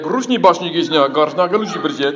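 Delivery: mock Russian gibberish; fake Russian. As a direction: bluff confidently in made-up Russian. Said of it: "I speak Russian too".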